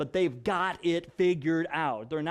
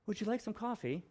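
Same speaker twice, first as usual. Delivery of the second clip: flirtatious; 2 semitones higher, 8 dB quieter than usual. Shown as a demonstration. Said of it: "It can sound flirtatious".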